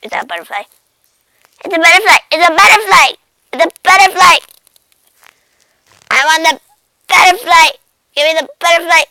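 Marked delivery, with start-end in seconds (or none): 1.6-9.0 loud, frantic excitement; excited cat. Acted. Cat spotting a butterfly it wants, excited shouting. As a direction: shout it eagerly, mid-pounce.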